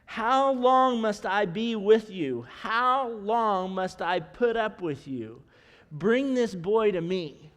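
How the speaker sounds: slow, weighty exasperated rebuke; frustrated Jesus. Acted. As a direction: drag out each word, fed up with their lack of faith. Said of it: "Jesus was clearly frustrated".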